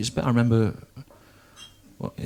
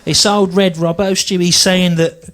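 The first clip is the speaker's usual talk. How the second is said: nan; 6 semitones higher, 4 dB louder than usual. Retold or imitated. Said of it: "She goes".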